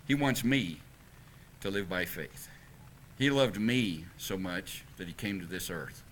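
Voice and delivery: a preacher tells the gospel softly to the congregation; hushed and tender. Acted. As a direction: bring them in close and speak gently. Intimate close of the sermon.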